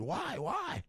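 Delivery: very high, soft whine; a groggy kid asking why, high and quiet; sleepy whiny why. Acted. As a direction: woken sleeper whining back, high and soft. Sleepy reply in the skit.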